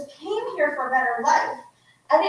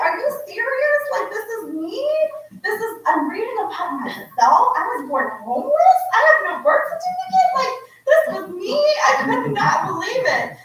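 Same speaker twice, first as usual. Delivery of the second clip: can't believe what you're reading about yourself; reliving the shock of reading her refugee past; stunned disbelief; 8 semitones higher than usual. Retold or imitated. Disbelief reading own history.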